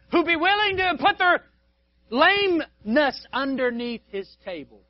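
loud, high, then slow and drawn out; preaching peak. Acted. Preacher describing the gracious king, loud slow preaching. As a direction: swell it up then stretch it.

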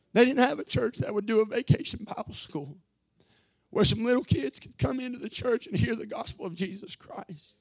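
high, strained voice on the verge of tears; real emotion breaking into the voice while preaching; choked with emotion. Real, not acted. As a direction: plead through rising tears, pitched well up. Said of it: "I'm sorry for all the emotional aspect".

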